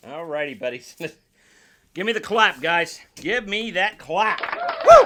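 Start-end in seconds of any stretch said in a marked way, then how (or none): 4.4-5.0 very high, very loud cheer; excited whoop. Real, not acted. Hyping up viewers before the unboxing, an excited whoop. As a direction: whoop like a crowd at a gig.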